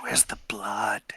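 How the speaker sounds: whispered hungry craving; creepy whisper. Acted. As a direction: hiss it softly, craving blood.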